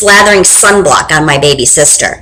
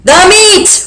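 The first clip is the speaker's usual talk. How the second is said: higher, roaring; crew roar; 9 semitones higher than usual. Acted. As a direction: roar it back. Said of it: "we all roared".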